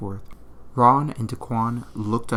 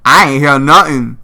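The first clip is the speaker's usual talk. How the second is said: loud dismissal; 4 semitones higher, 17 dB louder than usual. Acted. Teen brushes off the scream.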